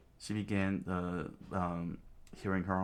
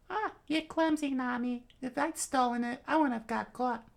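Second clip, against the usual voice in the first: very high, put-on child voice; cocky little girl in falsetto; teasing young sister; 4 dB louder than usual. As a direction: needle your sister in a squeaky kid voice. Young Nojiko teases Nami.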